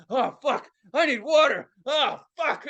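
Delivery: high, pained moaning, imitated; suffering dad. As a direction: groan like a dad desperate for relief.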